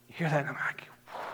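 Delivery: breathy whispered aside, voice dropped away. Real, not acted. Pastor whispering an aside to the congregation. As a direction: lean in and whisper it.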